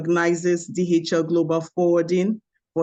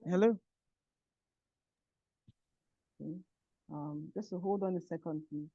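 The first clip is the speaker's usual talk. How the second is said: very faint, distant voice; weak, far-off speech; barely audible; 13 dB quieter than usual. Real, not acted. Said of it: "your voice is very low".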